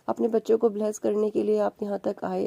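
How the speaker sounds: usual speaking voice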